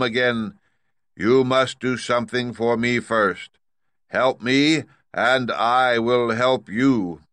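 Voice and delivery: loud, high, slow commanding giant-head voice; booming great head. Acted. Great head demanding payment from Dorothy, loud and stern. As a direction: lay down the law from the throne.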